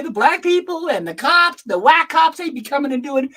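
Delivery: high mock comedian routine voice; mocking comedian bit. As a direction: parody the routine with scorn.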